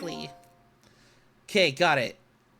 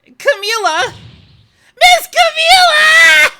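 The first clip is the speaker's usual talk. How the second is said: much higher, much louder drawn-out cry; anguished shout; 15 dB louder, slower than usual. Acted. Missile crying out for Camila, far higher and louder. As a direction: scream her name in despair.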